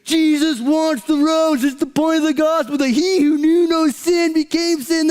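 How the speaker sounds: soaring pulpit proclamation; Matt Chandler impression. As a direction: belt it out like a preacher at full force. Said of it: "That's my mocker. That's my, um, impersonation of Matt Chandler".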